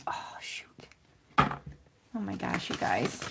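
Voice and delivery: whispered, slow dismay. Real, not acted. Crafter realising she stamped wrong, whispered. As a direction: breathe it out on the mistake.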